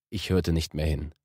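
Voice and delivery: ordinary delivery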